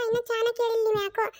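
ordinary talk, nothing marked

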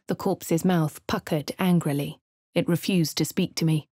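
ordinary talk, nothing marked

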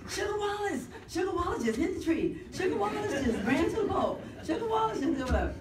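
put-on high, excited kids calling out; kids shouting. Neighborhood kids running down the street, shouting high. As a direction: yell it like neighborhood kids spotting him. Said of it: "the kids would run down the street".

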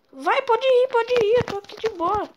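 high, loud urgent urging; being chased. Real, not acted. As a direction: push them to go, fast. Covering teammate under fire. (apressado, sob ataque)

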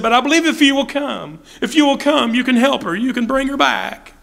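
high, pleading father's plea. Retold or imitated. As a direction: beg Jesus to come, voice lifted high. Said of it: "He said".

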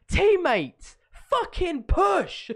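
high, loud, slow mock shout; mock yell. Imitating Mark shouting at teammates, high mock yell. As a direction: bellow it like a raging teammate. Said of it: "he was like".

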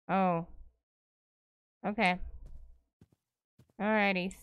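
ordinary talk

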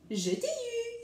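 high-pitched triumphant gotcha; storybook cry of triumph; exclaiming. A narrator's reading. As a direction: exclaim it high, as if pouncing on prey. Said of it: "s'exclama-t-il".

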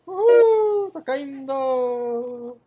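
high loud whoop then slow; excited whoop. Real, not acted. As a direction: cheer while falling. Falling down in game.

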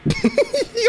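high cackling villain laugh; evil laugh. Pretend. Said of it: "Do your evil laugh then".

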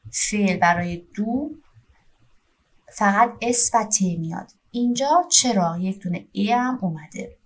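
ordinary delivery

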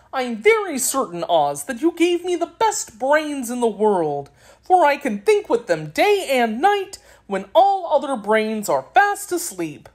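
cheery Scarecrow character. A narrator's reading. Scarecrow answers the wizard.

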